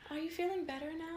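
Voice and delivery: put-on high, soft little-girl voice; daughter's voice. Daughter checks on mom after bad mood, high soft child voice. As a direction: imitate a small child checking on mom gently. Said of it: "the next day she'll be like".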